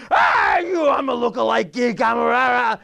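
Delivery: half-shouted gibberish tirade; mock ranting yell. Angry driver yells at the guy's window, hammed-up rant. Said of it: "the whole time you're going".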